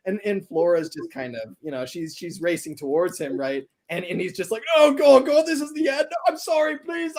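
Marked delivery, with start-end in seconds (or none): 4.8-7.1 panicked pleading. Acted. Stuck driver panics at oncoming train.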